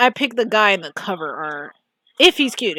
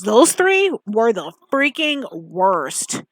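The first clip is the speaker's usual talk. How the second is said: ordinary talk, nothing marked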